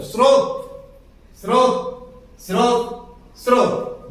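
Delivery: स्रोत said clearly with a tight स्र cluster; repeated model word. Shown as a demonstration. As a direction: drill स्रोत again and again, crisp cluster. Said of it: "अब बोल के देख लीजिए".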